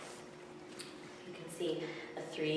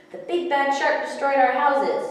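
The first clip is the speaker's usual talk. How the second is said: alarmed fish; 7 semitones higher, 7 dB louder than usual. A narrator's reading. Fish tell Kim the news.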